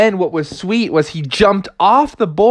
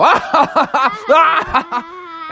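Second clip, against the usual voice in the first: high, long mock-evil laugh; villainous cackle; 14 semitones higher than usual. Shark about to eat frog, villainous cackle.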